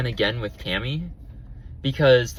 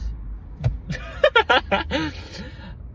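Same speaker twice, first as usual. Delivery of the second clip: airy laugh; 13 semitones higher than usual. Real, not acted. Laughing at her own rant.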